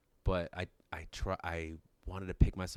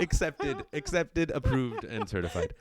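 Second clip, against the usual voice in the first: loud, proclaiming; hammed-up bureaucrat; mock official; 5 semitones higher, 7 dB louder, slower than usual. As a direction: stamp it like an official. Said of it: "mock official stamp".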